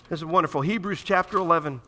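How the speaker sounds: usual speaking voice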